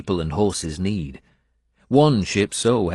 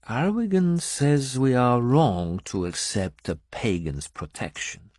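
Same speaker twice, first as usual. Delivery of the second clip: flat; 6 semitones higher than usual. A narrator's reading. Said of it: "she said flatly".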